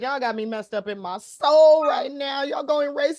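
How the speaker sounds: imitating a yelling girl. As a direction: mimic the girl yelling at the cops, half laughing. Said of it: "She said".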